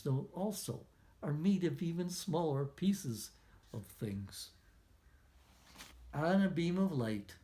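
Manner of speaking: usual speaking voice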